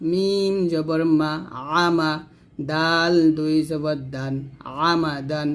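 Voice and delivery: normal talking voice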